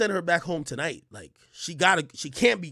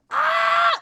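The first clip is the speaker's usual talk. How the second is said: disgusted yelp; 6 dB louder than usual. Retold or imitated.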